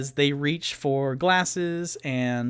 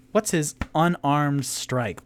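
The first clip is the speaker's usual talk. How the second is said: ordinary delivery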